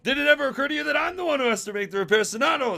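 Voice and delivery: exasperated complaint. Acted. Rotor complaining.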